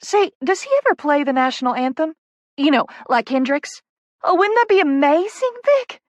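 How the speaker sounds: high, loud Southern gushing rising to a squeal; near-squeal of excitement. A narrator's reading. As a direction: build eagerly until you nearly squeal. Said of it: "She all but squeals with excitement".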